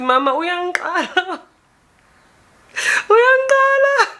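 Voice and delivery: high squealed cry for help; mock wail for Mama. Acts out wanting to cry to mother.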